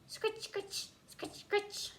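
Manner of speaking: high, breathless whispered scritch; whispered scratching noise. A narrator's reading. Narrator mimics the steady scratching above the classroom. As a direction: hiss the scratching sound softly.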